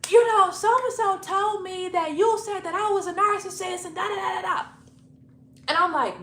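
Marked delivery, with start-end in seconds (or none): 0.1-4.7 high, loud mock accusation; upset girlfriend's voice. Girlfriend confronting a visitor at the door, high accusing imitation. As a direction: imitate an aggrieved girlfriend, pitched way up.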